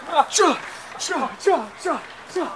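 playful kung-fu whooshing; mock fight swooshes. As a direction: make quick karate-chop sounds with your mouth. Mock fight move sounds.

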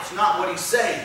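ordinary talk, nothing marked

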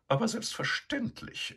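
high, lofty dismissal; haughty sow. A narrator's reading. Begonia lying in his stall, haughty and high. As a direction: brush him off without looking.

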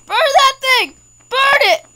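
high frantic shout; panicked yell. Real, not acted. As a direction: yell to burn the monster. Yelling at monster.

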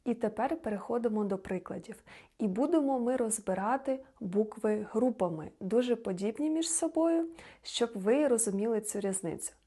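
ordinary delivery

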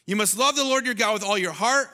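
usual speaking voice

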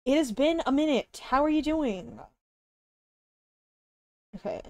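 ordinary talk, nothing marked

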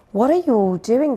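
high, loud curious call; crying out. A narrator's reading. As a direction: call out to the whole crowd. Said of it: "they cried".

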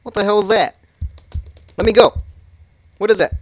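loud panicked cries. Real, not acted. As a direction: panic as you're grabbed. Grabbed by monster.

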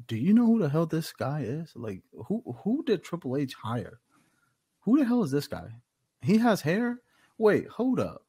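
retold bafflement, pitched high at who this guy is; baffled disbelief. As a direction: imitate stunned, incredulous onlookers. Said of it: "Jimmy and Roman are just like".

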